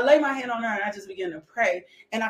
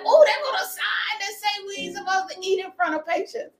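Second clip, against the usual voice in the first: higher voice mimicking a fretful mom; imitating mom; 11 semitones higher than usual. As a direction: play mom warning about the sign, higher. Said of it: "She was like".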